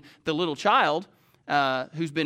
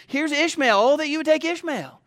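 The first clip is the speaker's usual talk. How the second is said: higher pleading voice for Abraham; 9 semitones higher, 4 dB louder than usual. Retold or imitated. Abraham begs God to take Ishmael, pleading higher. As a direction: beg God to spare the son, pitched up.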